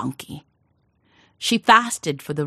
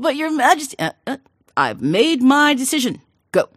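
pleading farmer; 10 semitones higher, 5 dB louder than usual. Acted. Farmer pleads, king dismisses.

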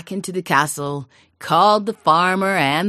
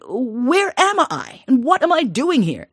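high, louder, slow alarmed questions; bewildered; 8 semitones higher, 5 dB louder than usual. Acted. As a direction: wake up lost and alarmed. King waking in strange cottage.

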